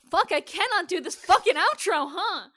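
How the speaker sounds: frustrated outburst. Real, not acted. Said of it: "frustrated at fumbled outro".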